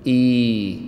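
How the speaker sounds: إي held as a steady long "ee"; long madd vowel. Shown as a demonstration. Teacher showing students the madd letters, long held "ee". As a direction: stretch إي into one sustained "ii" sound. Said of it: "بعكس المد".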